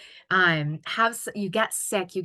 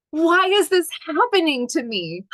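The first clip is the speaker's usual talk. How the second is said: raised, aggrieved why-me voice; whiny self-pity; 7 semitones higher, 5 dB louder than usual. Retold or imitated. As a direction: complain like a victim. Acted self-pitying complaint.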